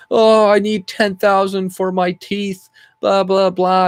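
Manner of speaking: mocking whiny voice. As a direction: drag it out like a spoiled complainer. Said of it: "this bitch is gonna be like".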